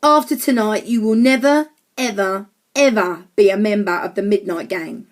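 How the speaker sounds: put-on emphatic, cross boy; snappy. A narrator's reading. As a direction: lay down the ban, leaning on each ever. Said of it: "snapped George".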